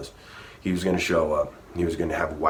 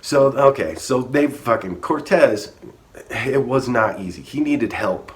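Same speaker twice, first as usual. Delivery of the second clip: excited telling; 7 semitones higher, 6 dB louder than usual. Real, not acted. Excited storytelling burst.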